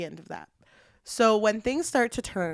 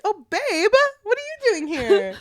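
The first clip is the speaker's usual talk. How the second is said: high, innocent sing-song babe; mock sweet husband; 12 semitones higher, 7 dB louder than usual. Husband greeting wife, high and falsely sweet. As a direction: play the clueless husband, all sweetness. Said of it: "And then he's like".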